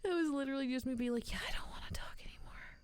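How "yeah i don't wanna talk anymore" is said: quick, weary whisper; mumble-whisper as if too tired to speak; lazy whisper. Retold or imitated. Streamer retelling why she started whispering, quick lazy whisper. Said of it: "I decided that I needed to whisper".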